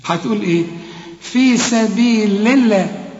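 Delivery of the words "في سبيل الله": "الله" with a thin l after "سبيل"; slow, higher recitation of "في سبيل الله"; light lam. Shown as a demonstration. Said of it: "رقق لفظ الجلالة".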